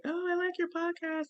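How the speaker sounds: high, light-voiced praise; imitated compliment. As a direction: pitch it up like an admiring cousin. Voicing cousin's compliment.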